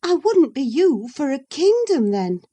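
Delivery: mean it fully; emphatic declaration. Acted. Catherine to Isabella, emphatic and sincere. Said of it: "Catherine declared emphatically".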